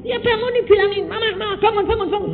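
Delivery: retold divine instruction, lightly sung out; God's instruction voiced. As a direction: show how to wake her, coaxing. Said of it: "Bangunin bagaimana?".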